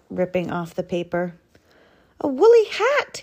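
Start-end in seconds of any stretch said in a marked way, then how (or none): 2.2-3.2 high-pitched rabbit exclamation; excited, squeaky rabbit voice; delighted cry. A narrator's reading. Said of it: "he cried".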